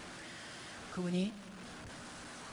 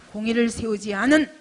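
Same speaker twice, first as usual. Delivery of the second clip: full-force preaching; 2 semitones higher, 16 dB louder than usual. Acted. Pastor pressing the congregation, preaching at full force. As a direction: preach it out to the whole hall.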